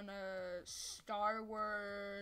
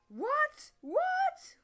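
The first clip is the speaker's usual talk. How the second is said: surprised; 11 dB louder than usual. Real, not acted. Sudden surprise in game.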